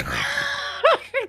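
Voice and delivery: squealing laugh; high-pitched laugh. Real, not acted.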